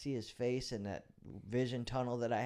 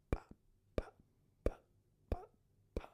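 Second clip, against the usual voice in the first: soft pop sounds; 5 dB quieter than usual. Shown as a demonstration. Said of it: "It makes this noise".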